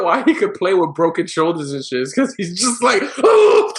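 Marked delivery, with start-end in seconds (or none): 3.2-3.7 sudden high yell of pain; hammed-up cry as if hurt; mock pained yell. Mimed player playing through pain.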